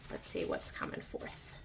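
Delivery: hushed half-whisper to herself; soft whispered aside. Real, not acted. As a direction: murmur it under your breath while shuffling. Quiet murmur while shuffling cards.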